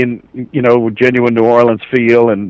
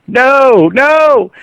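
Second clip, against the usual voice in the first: rising protest; 11 semitones higher than usual. Retold or imitated. Freaking out reading Ray's death.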